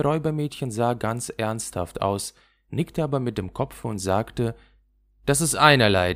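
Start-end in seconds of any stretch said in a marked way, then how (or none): 5.2-6.1 louder, brushing it off; dismissive and loud; robber girl. Acted. Räubermädchen wischt es weg. (laut und abwinkend)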